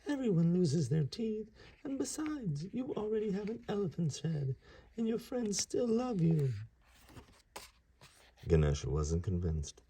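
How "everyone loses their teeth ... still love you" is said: much higher squeaky comforting voice; squeaky mouse. Acted. Mouse comforting Ganesha, a high squeak. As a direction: reassure him in a tiny mouse voice. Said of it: "said Mister Mouse".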